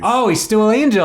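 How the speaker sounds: high, loud "oh!" of realizing; light up as it clicks; surprised recognition. Real, not acted. Sudden recognition of actor.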